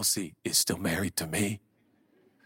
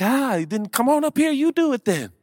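sarcastic challenge; 13 semitones higher, 8 dB louder than usual. Retold or imitated. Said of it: "And I'm like".

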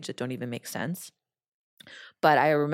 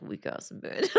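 whispered aside; 5 dB quieter than usual. Retold or imitated. Mocking friend's sobbing reaction.

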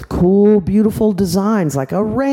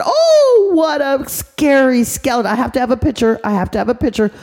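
delighted mommy; 7 semitones higher than usual. Acted. Mommy gushing over her son's skeleton face, high and excited. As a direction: gush over it like a thrilled mom.

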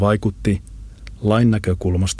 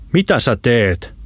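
drawn-out, higher-pitched call; shouting; 4 semitones higher, slower than usual. A narrator's reading. Lambo calls to his friend in the kitchen, shouting slowly. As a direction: call across to someone in another room. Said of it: "hän huusi Holopaiselle".

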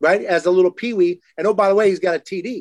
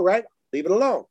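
ordinary talk

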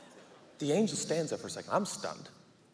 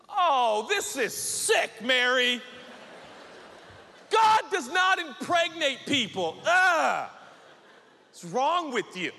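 high, drawn-out disgusted yelling; appalled outburst; 11 semitones higher, 4 dB louder, slower than usual. Acted. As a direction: recoil loudly in acted outrage. Joe appalled in acted quarrel.

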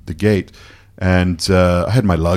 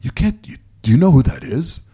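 alarmed warning; 8 semitones higher, 3 dB louder than usual. Retold or imitated. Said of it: "my friend came up to me and goes".

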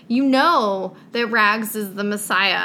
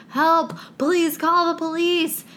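mock urgent plea; 7 semitones higher than usual. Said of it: "Instead of like".

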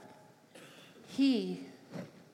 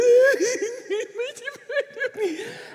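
high loud mock crying wail; imitated child wail; 14 semitones higher, 7 dB louder than usual. A child crying from the dining room, imitated as a high wail. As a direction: wail up high like a kid bursting into tears. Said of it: "all of a sudden I hear".

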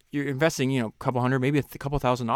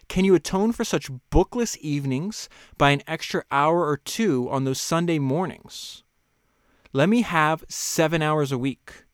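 raised, measured reading voice; reading a quote aloud; 3 semitones higher, 4 dB louder than usual. Acted. Host reading a book passage to listeners, measured. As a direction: read the author's words with weight.

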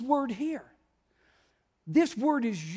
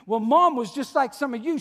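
ordinary delivery

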